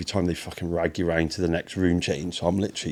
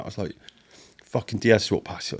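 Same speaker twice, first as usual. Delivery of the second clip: ordinary delivery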